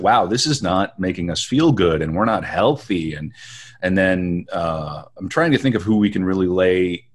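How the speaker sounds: ordinary delivery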